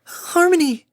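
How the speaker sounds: loud, very high cry of the name; panicked cry. Acted. Fluttershy cries to Harmony for help, panicked.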